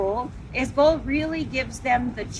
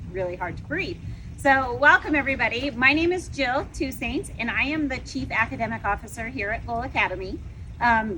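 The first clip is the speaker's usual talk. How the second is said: ordinary talk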